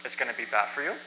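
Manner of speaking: ordinary talk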